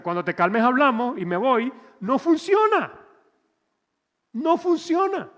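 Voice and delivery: high, loud cry of no funciona; preaching shout. Real, not acted. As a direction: belt it out from the pulpit. Preaching at full force.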